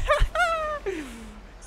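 very high, loud cackle; real giddy laugh; shrieking victory laugh. Real, not acted. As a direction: howl with laughter. Celebrating the kill.

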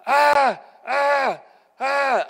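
put-on shrill little-girl screaming, loud and high; loud high mock scream; high girlish screams. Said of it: "I started screaming like a little girl".

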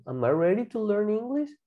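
flowing, no pauses, rising on "English"; whole connected question. Shown as a demonstration. Teacher repeats the learning question, connected and rising. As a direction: run the words together as one question.

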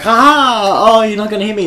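high, jeering bully imitation; loud fake laugh, then tease him; mocking taunt. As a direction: crow at him like a schoolyard bully. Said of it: "like, mocking him".

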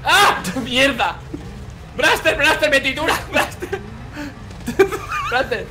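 laughing panic yell. Real, not acted. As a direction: scream for help while cracking up. Crushed in grinder, yelling laughing.

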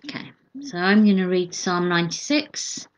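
ordinary delivery

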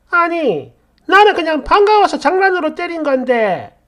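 high-pitched, defensive protest; aggrieved self-defence in an acted row; protesting excuse. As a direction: protest that it was only a joke. Accused bully protests in shouting match.

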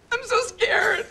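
high, teary fear; tearful fear. Acted. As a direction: whimper it out like a scared child. Daughter confessing fear, crying.

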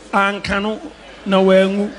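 ordinary talk

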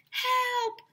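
high, loud call for help; high desperate cry. A narrator's reading. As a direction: call out trapped under rubble. Trapped after cave-in.

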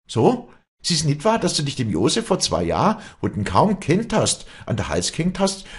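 high, loud tirade; heated cross-examination at high pitch; furious accusation. Acted. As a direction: pile up accusing questions, voice leaping up. Acted quarrel, public accusation.

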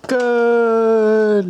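high, loud excited cheer. Real, not acted. Cheering daughter's ball kicks.

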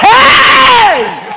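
long, high, booming yell of protest; shocked shout. Retold or imitated. Husband catches boy on wife's breast, shocked shout. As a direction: holler it out, drawn out and high. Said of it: "I said".

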